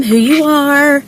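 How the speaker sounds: ordinary talk, nothing marked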